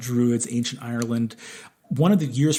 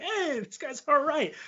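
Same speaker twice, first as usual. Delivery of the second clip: imitating Romans; 13 semitones higher, 4 dB quieter than usual. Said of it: "And they're like".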